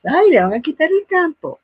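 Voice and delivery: high, sing-song mock pity; teasing adult voice. Adults teasing the older child, high mock pity. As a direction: tease the child about losing his place.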